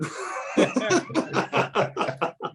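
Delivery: high, breathless silent laugh; wheezing laugh. Real, not acted. Big laugh at the story.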